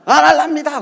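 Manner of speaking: acted defiant outburst; defiant shout. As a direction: shout back at your superior. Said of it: "목사님한테 막 큰 소리 치면서".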